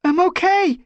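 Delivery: high, loud reassuring call; calling back. Acted. Squirrelpaw answering Brambleclaw, loud call. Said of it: "she called".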